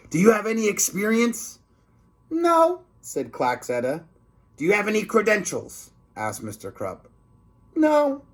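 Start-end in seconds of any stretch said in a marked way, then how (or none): 0.1-1.6 higher stern principal; lightly voiced grumpy principal; a hint of a gruff principal. 2.3-2.8 high put-on sneaky lunch lady; high-pitched scheming cafeteria lady. 4.6-5.9 higher stern principal; lightly voiced grumpy principal; a hint of a gruff principal. 7.7-8.2 high put-on sneaky lunch lady; high-pitched scheming cafeteria lady.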